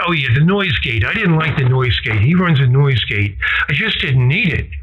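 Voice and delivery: normal talking voice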